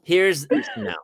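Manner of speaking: ordinary talk, nothing marked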